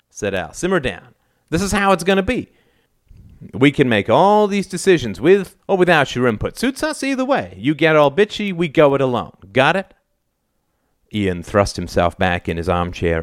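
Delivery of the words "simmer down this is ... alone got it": raised, high-pitched angry insistence; exasperated father's warning; stern ultimatum. A narrator's reading. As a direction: lay down the law, pitch climbing. Father lays down the law angrily.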